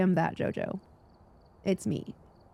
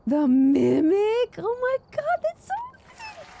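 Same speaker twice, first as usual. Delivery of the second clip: overwhelmed excitement; 4 dB louder than usual. Real, not acted. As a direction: squeal rising to a tiny high squeak. Sees the Mimic trophy.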